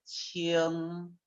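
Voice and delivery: "qing" as a drawn-out Chinese syllable; pronunciation of "qing". Shown as a demonstration. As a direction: model "qing" softly for learners, held long. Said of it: "pronunciación es".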